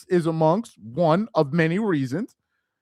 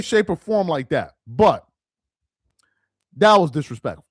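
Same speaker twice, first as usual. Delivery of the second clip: ordinary talk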